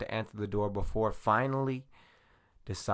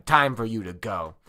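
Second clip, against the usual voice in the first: loud; 3 semitones higher, 10 dB louder than usual. Acted. Jackson threatening a door.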